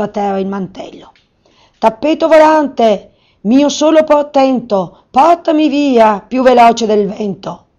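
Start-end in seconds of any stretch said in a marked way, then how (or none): 1.8-7.7 high, loud, slow spell chant; the wizard commanding his flying carpet; commanding incantation. Acted. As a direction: intone the order to the carpet like a spell. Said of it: "ordinò".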